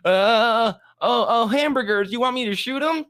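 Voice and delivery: put-on high hesitant kid voice; nervous Butters. Butters asking Cartman, high nervous stammer. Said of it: "And he's like".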